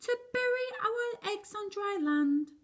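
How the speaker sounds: high, bright little turtle voice; high chirpy sea turtle. Acted. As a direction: squeak it out like baby turtles.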